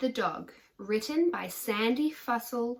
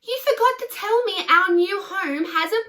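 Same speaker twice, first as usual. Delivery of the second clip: put-on high, loud, thrilled child voice; excited boy; 9 semitones higher, 9 dB louder than usual. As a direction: burst out with delight.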